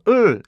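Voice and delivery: 을 as a forced Korean ㄹ syllable; wrong L. Shown as a demonstration. Teacher warns against forcing the L, Korean pronunciation. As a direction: push "을" out as a full Korean eul. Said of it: "류를 내려고 하지 마세요".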